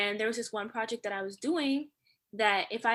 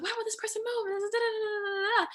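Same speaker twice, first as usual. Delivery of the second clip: high, drawn-out mock road-rage wail; 11 semitones higher, much slower than usual. Driver re-enacting her old road rage, high mock fury. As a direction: whine in exaggerated exasperation at another driver. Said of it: "I used to get really bad road rage".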